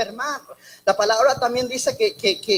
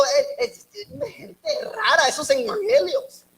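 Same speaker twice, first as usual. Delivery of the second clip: higher, put-on voice of a suspicious newcomer; mock wary outsider; 8 semitones higher, slower than usual. Pastor mimics an outsider's distrust of evangelicals, higher mocking voice. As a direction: play the skeptic scoffing at strange churchgoers. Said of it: "tal vez, digo".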